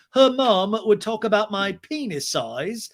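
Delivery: high, loud, slow impersonation; James. Retold or imitated. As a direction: stretch it out in a hammed-up man's protest. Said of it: "and James is like".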